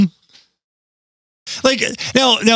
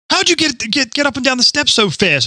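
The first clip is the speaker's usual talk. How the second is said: high quoted voice; 9 semitones higher than usual. Retold or imitated. Retold question from wedding guests.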